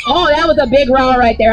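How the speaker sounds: normal talking voice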